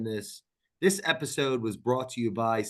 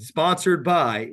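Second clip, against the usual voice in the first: slow, loud sponsor announcement; commercial read; 3 semitones higher, 5 dB louder, much slower than usual. Pretend. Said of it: "I'm trying to do my commercial".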